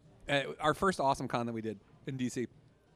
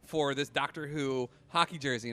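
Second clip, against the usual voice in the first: ordinary talk, nothing marked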